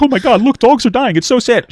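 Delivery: high, loud whiny mock sorrow; mocking vegan teacher. Streamer parodying the vegan teacher, whiny mock lament. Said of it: "And then she's like".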